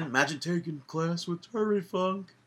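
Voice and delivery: a hint of Terry Funk; lightly voiced old wrestler; Terry Funk impression. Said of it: "That's a terrible impression".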